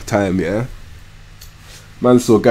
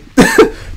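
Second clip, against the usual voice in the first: laugh; 3 dB louder than usual. Real, not acted. Amused at the player's reaction.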